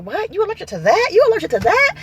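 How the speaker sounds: imitating astonished people, high rising questions; incredulous. Everybody reacting to her allergies to the good stuff, incredulous. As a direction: question it in mock disbelief, pitch climbing.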